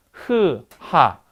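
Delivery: isolated 흐, a pause, then a falling 하; contrasted 흐 and 하. Shown as a demonstration. Teacher lets students feel the articulation shift, sounding out 흐 and 하. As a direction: sound out 흐 and 하 separately to show the difference. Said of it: "조음되는 위치 좀 다른 거".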